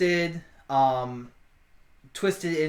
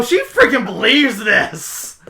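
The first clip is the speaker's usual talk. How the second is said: normal talking voice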